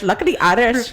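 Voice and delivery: badly faked Irish accent; muddled Irish accent. Laughing with co-hosts over a failed accent, mock Irish. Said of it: "I don't even know what accent I was doing".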